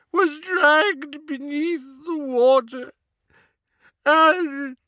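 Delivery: very high, slow wail between rasping sobs; sobbing house-elf. A narrator's reading. Elf recounting his master's death, racked with sobs. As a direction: gasp it out phrase by phrase, crying. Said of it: "Kreacher's sobs came in great rasps now".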